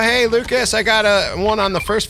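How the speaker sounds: calling out. Real, not acted. Player alerting teammate Lucas to a find, calling out. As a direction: pipe up high so your teammate hears.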